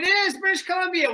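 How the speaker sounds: excited. Real, not acted. Host excited by local answer.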